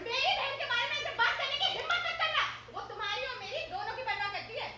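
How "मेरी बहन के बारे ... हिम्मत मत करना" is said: loud, indignant warning; angry scolding. Acted. Wife angrily warning husband about her sister. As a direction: warn him off insulting her sister, with a laugh.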